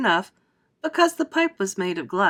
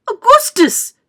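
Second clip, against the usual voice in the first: very high, loud mother's call; mother's cry; 11 dB louder, slower than usual. A narrator's reading. Mother cries out to her son at the river, high. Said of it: "cried Missus Gloop".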